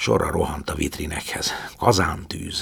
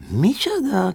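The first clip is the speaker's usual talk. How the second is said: high, puzzled what; baffled question; slower than usual. A narrator's reading. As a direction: ask blankly, not understanding. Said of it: "kérdezte valamennyi". (értetlen, magas kérdés)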